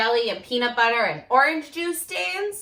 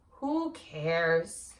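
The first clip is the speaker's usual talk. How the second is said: sulky who cares; 9 semitones lower, 6 dB quieter than usual. Acted. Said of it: "He says".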